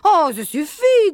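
loud, high, slow rebuke; exasperated outburst. A narrator's reading. Caller fed up with quacking on the phone, exasperated. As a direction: raise the voice, stretching it out in exasperation.

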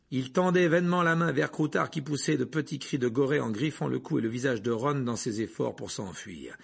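ordinary delivery

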